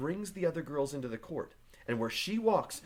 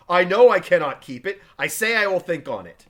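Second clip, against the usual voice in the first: loud, raised, exasperated shout; angry outburst; 5 semitones higher, 14 dB louder than usual. Acted. Husband losing patience with wife, angry shout. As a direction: bark it back to end the nagging.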